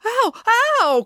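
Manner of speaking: two pained yelps; cried out in pain. A narrator's reading. Joe bitten on hand and leg, crying out. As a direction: cry out as if bitten. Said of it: "cried Joe".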